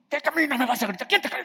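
high, loud mock defiance; imitated angry retort. Person insulted on the street snaps back in mock anger. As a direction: snap back at the insult, hammed up.

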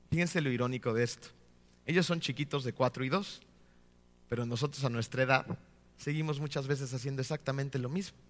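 usual speaking voice

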